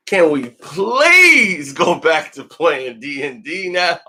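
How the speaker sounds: mock begging, stretching "please" right out; imitating a fed-up kid, long rising "please"; exasperated plea. Voicing a fed-up boy pleading.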